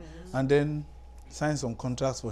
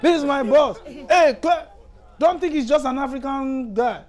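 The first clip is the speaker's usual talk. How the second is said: loud, high, gleeful announcing to the whole bank; bragging shout about his rich boss; retold excited shouting; 11 semitones higher, 11 dB louder than usual. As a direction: shout it out proudly to the room. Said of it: "he started shouting".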